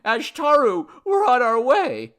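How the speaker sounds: high, loud jubilant call; excited shout. A narrator's reading. Man telling the god they're on their way, shouting. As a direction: shout it in triumph. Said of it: "he shouted".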